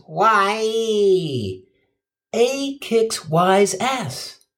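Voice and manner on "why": mock catchphrase, long and drawn out; lame catchphrase. Comic mocking a lame version of the catchphrase, drawn out. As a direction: stretch it into a lame greaser parody. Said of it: "The Fonz didn't say some lame ass".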